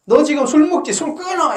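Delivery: mock scolding a drinking friend; scolding order. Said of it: "이렇게 안 해요".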